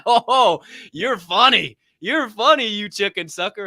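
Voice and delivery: loud, high mock accusation; hammed-up "gotcha" to a prankster friend; playful teasing. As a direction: tease it out, loud and sing-song. Acts teasing God, looking up.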